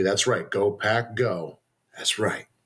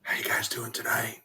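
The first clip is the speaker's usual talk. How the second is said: whispering; 4 dB quieter than usual. Real, not acted. Whispered greeting, quiet movie theme.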